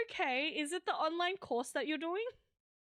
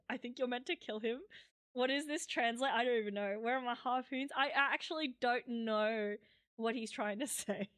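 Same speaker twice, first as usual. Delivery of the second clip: ordinary delivery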